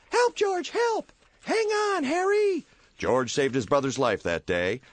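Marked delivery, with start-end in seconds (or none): 0.1-2.7 put-on very high child's cry for help; panicked child. Boy calls for help from the ice, high and panicked. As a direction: shout like a kid in the ice.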